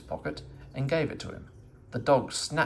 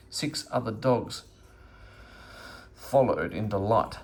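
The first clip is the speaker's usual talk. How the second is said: ordinary delivery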